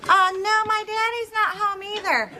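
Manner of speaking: high-pitched pretend child on the phone; bad little-kid impersonation. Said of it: "bad impersonations".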